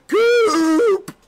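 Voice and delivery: high, loud mocking yell of the name; reliving the yell, loud and high; retold yelling. Real, not acted. Taunting the player again.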